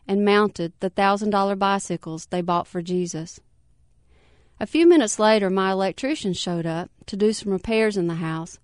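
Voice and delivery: ordinary delivery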